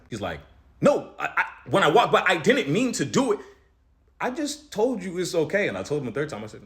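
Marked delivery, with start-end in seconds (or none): 0.8-5.7 high, loud, defensive guy voice; flustered over-explaining. Retold or imitated. The gym guy protesting to the speaker, high and loud. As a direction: voice him scrambling to justify himself. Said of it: "he just starts over-explaining himself again".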